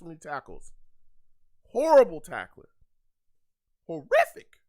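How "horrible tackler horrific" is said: loud, disgusted verdict, each word spat out; angry outburst. Real, not acted. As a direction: bark it at the screen in disgust. Fan blasting a bad tackle.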